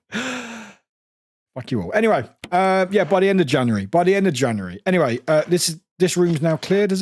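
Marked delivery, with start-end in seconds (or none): 0.1-1.9 slow, laughing fuck you all; laughing repeat. Retold or imitated. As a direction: giggle through the words. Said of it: "That was aggressive".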